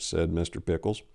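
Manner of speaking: plain reading voice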